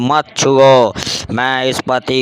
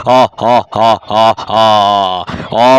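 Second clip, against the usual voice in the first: witch cackle; 3 semitones lower, much slower than usual. Acted.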